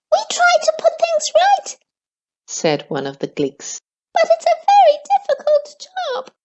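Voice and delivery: very high, fast little creature; squeaky creature voice. A narrator's reading. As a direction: chirp it out like a tiny forest creature. Gleek voice held in story.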